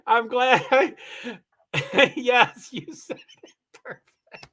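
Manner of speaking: high, giggly voice breaking up into laughter; laughing. Real, not acted. Streamer reading Scarlett's reply to chat, laughing. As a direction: laugh through the words until the voice gives out.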